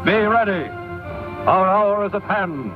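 vintage 1930s newsreel narration. Real, not acted. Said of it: "This is a newsreel from the early nineteen thirties".

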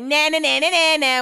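high, loud sing-song nah nah nah; taunting. Retold or imitated. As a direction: tease the hosts like a kid. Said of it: "I wanna be like".

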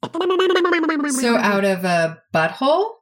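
high, slow disbelieving question; incredulous. Real, not acted. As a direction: ask it in delighted disbelief.

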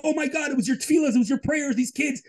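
high excited squeal, voicing her friends; high-pitched excited gushing. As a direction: gush like thrilled friends texting her. Friends texting excited praise.

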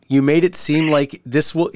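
ordinary talk

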